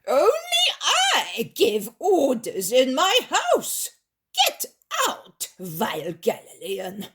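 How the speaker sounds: high, slow squeaky yell; squeaky shout. Acted. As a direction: shriek like a fox. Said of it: "shouts with his squeaky voice like fox's".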